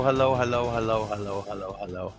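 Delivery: normal talking voice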